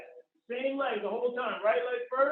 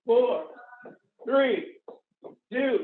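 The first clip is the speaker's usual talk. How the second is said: loud countdown; barking gym coach; 5 dB louder, slower than usual. Real, not acted. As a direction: count down loud to push them.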